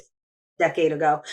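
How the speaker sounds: ordinary talk, nothing marked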